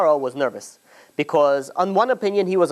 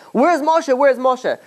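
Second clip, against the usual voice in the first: much higher, urgent calls; frantic calling; 9 semitones higher, much slower than usual. Retold or imitated. Pharaoh knocking on doors, frantically asking for Moshe. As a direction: knock and demand, desperate.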